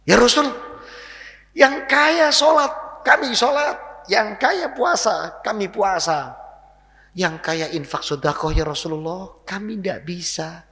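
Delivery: imitating a poor man's tearful complaint; tearful plea. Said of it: "itu nangis".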